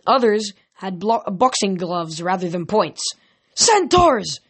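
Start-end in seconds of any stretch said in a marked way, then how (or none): 3.5-4.4 high-pitched yell of alert; sudden high cry. A narrator's reading. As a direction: call it out to everyone around. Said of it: "Annabeth yelled".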